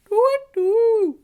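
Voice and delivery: loud high owl hoot; owl call. Shown as a demonstration. Leader doing one last loud, high owl hoot. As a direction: call out twit-twoo up high. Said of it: "a full twit to a twit".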